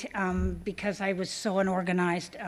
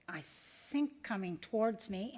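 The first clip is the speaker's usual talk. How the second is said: ordinary delivery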